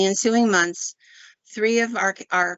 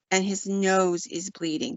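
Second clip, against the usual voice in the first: ordinary delivery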